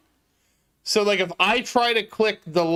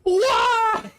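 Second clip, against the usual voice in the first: anguished cry; 13 semitones higher than usual. Real, not acted. Streamer to chat after a misclick, crying out in anguish. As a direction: wail the word to the sky.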